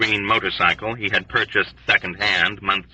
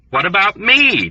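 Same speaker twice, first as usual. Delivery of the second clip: high, whiny demand leaning on me; petulant demand; 10 semitones higher, 3 dB louder than usual. A narrator's reading. Dunbar to the doctors, petulant demand. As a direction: sulk and insist on being included. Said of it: "Dunbar demanded petulantly".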